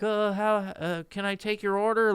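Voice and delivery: high, hesitant mock cashier voice. Mocking a McDonald's worker taking his order, high hesitant voice. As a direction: play a dopey fast-food worker. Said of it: "are like".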